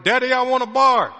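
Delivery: loud, high imitation of a bossy kid; demanding child. Voicing demanding daughter in the morning.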